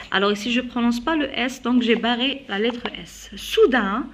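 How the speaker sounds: usual speaking voice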